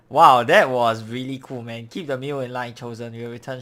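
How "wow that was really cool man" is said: loud, slow amazed wow; excited. Real, not acted. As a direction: blown away, calling it out. Player reacts to cool moment.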